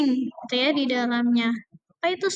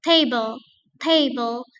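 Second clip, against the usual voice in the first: "table" with TA louder; stress on first syllable; 3 dB louder, slower than usual. Shown as a demonstration. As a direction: lean on TA in "table". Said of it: "suku kata pertama ini harus kita stress". (tekan "TA" pada "table")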